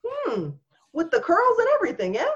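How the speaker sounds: high, prying mock voice; hammed-up nosy onlooker. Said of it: "they're like".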